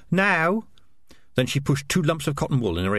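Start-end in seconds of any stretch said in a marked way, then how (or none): 0.1-0.6 high called shout down a pipe; narrated shout. A narrator's reading. Lookout on the roof shouting down a drain pipe, high call. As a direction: call out sharply, pitched up. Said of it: "Big Savings shouted down".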